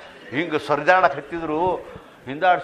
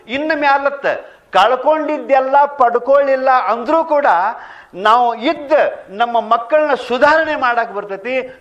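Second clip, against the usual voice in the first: much higher, louder wife's voice, urgent and earnest; pleading wife; 10 semitones higher, 8 dB louder than usual. Acted. Wife urging her crying husband to quit drinking, high loud pleading. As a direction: beg him to hand over his wages, heartfelt.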